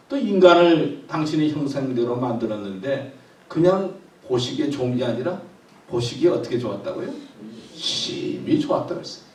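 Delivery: ordinary delivery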